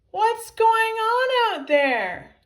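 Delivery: high, drawn-out loud holler; high-pitched slow yell; crying out. A narrator's reading. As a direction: call out loudly from inside a pocket. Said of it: "cried Tippy, Tiny Tippy".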